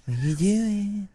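high squeaky bit voice trading silly greetings. Pretend. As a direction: pitch it way up like a goofy kid.

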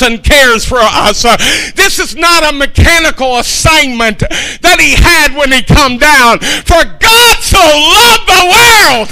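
high, driving pulpit delivery; urgent, impassioned sermon climax; fervent preaching. Real, not acted. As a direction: preach with rising fervor. Preaching at full force.

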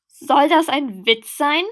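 loud indignant shout. A narrator's reading. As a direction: shout it in outrage. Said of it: "schrie ich".

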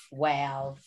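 ordinary talk

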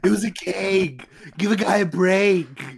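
mock protest. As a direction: plead your case in mock exasperation. Character protests at hard look.

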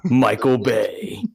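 dramatic movie trailer announcer, slowed down. Acted. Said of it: "I almost did my movie voice".

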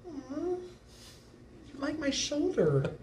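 slow, soft teasing coo; mock cooing. Teasing his friend about his shoulder, slow soft coo.

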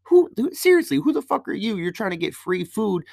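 high, incredulous outburst; upset confrontation. Retold or imitated. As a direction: confront them with the pitch shooting way up. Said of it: "we're, like, actually upset".

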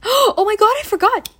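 gasping, loud high-pitched alarm; sudden realisation. Real, not acted. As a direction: gasp and blurt it out, high and loud. Gasps at sudden realisation of omission.

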